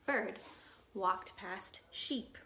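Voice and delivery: usual speaking voice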